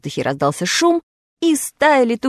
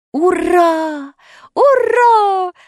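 higher, louder hurrah; joyful cheer; 11 semitones higher, 5 dB louder, much slower than usual. A narrator's reading. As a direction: cheer with delight. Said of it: "закричали все".

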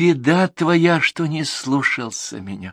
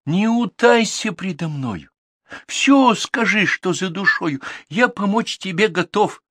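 raised, gentle questioning horse voice; caring little horse; 7 semitones higher than usual. Acted. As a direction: comfort a sad friend. Horse comforts Ivan.

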